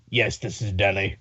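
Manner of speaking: very low, quiet demon voice. Retold or imitated. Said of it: "stereotypical demon voice".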